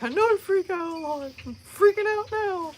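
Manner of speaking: high, wailing mock panic; freaking out. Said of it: "Stop freaking out".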